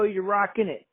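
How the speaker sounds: ordinary talk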